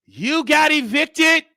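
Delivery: slow, loud, high-pitched proclamation; mock grand announcement. Returning guest announcing an eviction, mocking proclamation. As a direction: draw it out loudly like a big reveal.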